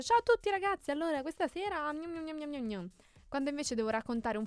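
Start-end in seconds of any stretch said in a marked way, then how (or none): 0.0-2.9 acuto e squillante, tono da invito; messaggio di gruppo allegro. Retold or imitated. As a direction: leggi l'invito come a tutti gli amici. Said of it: "se devo invitare tante persone faccio". (voce allegra da messaggio broadcast)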